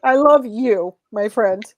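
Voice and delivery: choked-up, high and loud; tearful. Real, not acted. Guest tells her friend Elias she loves him, through tears.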